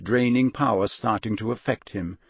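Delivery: ordinary delivery, nothing marked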